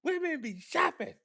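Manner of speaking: high, slow put-on comic voice; mock comedian voice, high and slow; comedian impression. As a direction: ham up the old stand-up bit, drawn out and way up high. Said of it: "He said".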